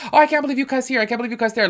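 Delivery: high squeaky voice of a scandalized follower; mock shocked commenter. Mocking followers shocked by her cussing, high and squeaky. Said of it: "People are like, y'all, people have told me before".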